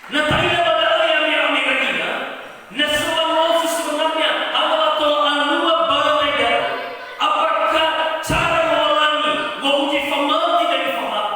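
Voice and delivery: loud, high, half-sung preaching over music; preaching at full force. Acted. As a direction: proclaim it like a song to the congregation. (khotbah lantang setengah dinyanyikan)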